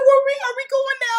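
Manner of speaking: high, fast, loud eager question; retold excitement. Mom eager to leave for the show, imitated excited rush. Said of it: "She was so excited".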